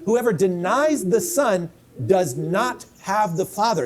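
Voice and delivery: slow, each word weighed, "denies" stressed; slow emphatic reading. Shown as a demonstration. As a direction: spell out "denies" deliberately, then fall. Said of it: "what does Scripture tell you?".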